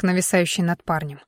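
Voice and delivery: ordinary delivery, nothing marked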